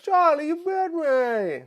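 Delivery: put-on loud, high British child wail; British kid. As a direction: cry out slowly as a bitten British boy. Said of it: "My brother went".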